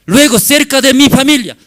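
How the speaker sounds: loud, high, fast preaching to the brothers; shouted sermon climax; fiery preaching. Acted. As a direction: drive it home from the pulpit. Preaching at full force.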